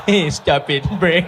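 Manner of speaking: words broken up by giggles; stifled laughter. Acted. Visitor trying to keep a straight face at Brake, giggling. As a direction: try to scold but crack up laughing.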